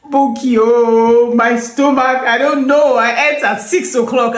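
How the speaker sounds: high, loud, drawn-out moaning complaint; whiny sick friend. Retold or imitated. Retelling her friend's stomach-ache complaint in a whiny voice. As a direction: moan it like a friend with a bad stomach.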